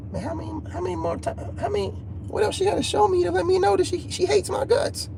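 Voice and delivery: high, hushed disbelief, rushing at first; exasperated inner voice. Retold or imitated. Man recalls asking himself why she hates him, exasperated. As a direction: think it aloud, high and incredulous. Voicing his own despairing thoughts.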